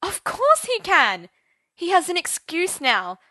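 loud, high distressed cry; desperate upset shout; distressed outburst. Acted. Arthur's outburst continues.

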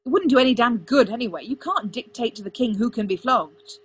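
loud, heated rebuttal; snapped. A narrator's reading. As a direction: argue back with irritated force. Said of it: "Arthur snapped".

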